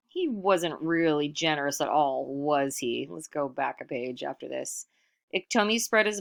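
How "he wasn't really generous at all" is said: plain reading voice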